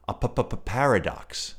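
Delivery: slow, stuttered p-p-p tiger voice; stuttering tiger. Acted. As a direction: bounce on each p of p-p-p-paradox. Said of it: "said the tiger".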